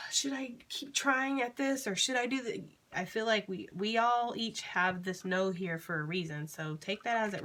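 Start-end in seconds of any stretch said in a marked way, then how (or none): 0.0-2.6 inner doubt voiced. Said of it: "For some of you, this is".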